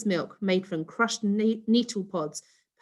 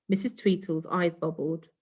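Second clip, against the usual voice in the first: ordinary delivery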